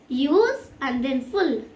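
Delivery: slowly, USE, then FULL as separate parts; drawn-out USE, a gap, then FULL; syllable break. Shown as a demonstration. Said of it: "We can break it easily".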